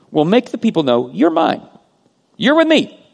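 high, loud declaration as God; emphatic claim. Acted. Preacher voices God claiming his people, emphatic. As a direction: proclaim it high and forceful, claiming them. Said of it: "it's actually emphatic".

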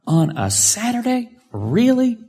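high, disbelieving complaint; can you believe it, on a Saturday; incredulous. Real, not acted. Preacher telling congregation of the crash, incredulous.

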